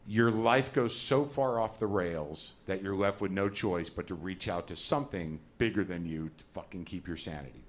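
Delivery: ordinary talk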